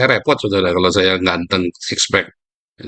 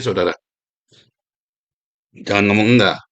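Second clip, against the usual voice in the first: normal talking voice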